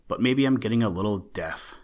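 ordinary delivery

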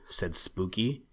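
ordinary delivery

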